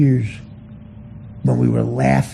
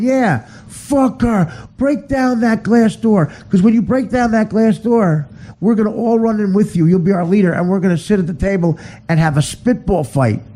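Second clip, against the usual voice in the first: rowdy fans cheering; 7 semitones higher than usual. Retold or imitated. Comic playing dumb fans cheering the host on. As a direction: egg him on like a fired-up fan. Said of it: "We're going".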